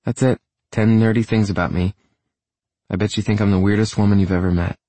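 lightly voiced, self-deprecating woman; hint of a chatty woman on the phone; quirky woman. Woman ends her list by phone.

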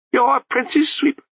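a high babbling abbot voice, breaking into nonsense; abbot's voice. A narrator's reading. The abbot scolds the crowd, high babbling voice.

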